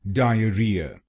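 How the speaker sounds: डायरिया in four syllables, dai-a-RI-a; डायरिया with stress on री, dai-a-RI-a; correct pronunciation. Shown as a demonstration. Teacher models the correct form for learners. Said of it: "इसकी सही pronunciation है".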